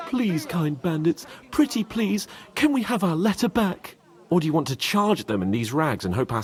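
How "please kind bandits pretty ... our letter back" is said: sarcastic, higher, wheedling mock plea; mock pleading to bandits. Mocking a polite plea to the bandits, higher and wheedling. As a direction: beg sweetly, dripping with sarcasm. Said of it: "Ask them nicely".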